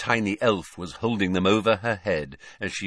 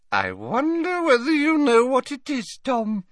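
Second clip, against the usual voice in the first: put-on doting old lady; 4 dB louder than usual. A narrator's reading. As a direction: fuss over him like a grandmother. Old witch teasing.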